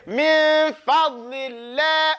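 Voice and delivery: slow chanted "min fadlillah", n held; dad demonstration. Shown as a demonstration. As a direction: stretch "min fadlillah" with a lingering heavy d. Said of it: "so you say".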